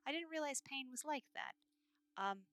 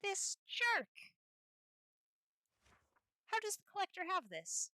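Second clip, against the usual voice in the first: furious discovery of a betrayal; outraged; 8 semitones higher than usual. Real, not acted. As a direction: spit it out indignantly. Discovering the collector's trick.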